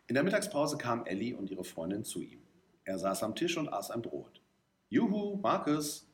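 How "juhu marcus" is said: high, drawn-out yoo-hoo; singsong greeting call, pitched well up. A narrator's reading. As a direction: hail someone with a slow, high call. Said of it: "riefen sie".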